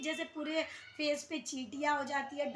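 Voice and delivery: ordinary delivery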